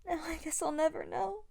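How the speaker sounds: ordinary delivery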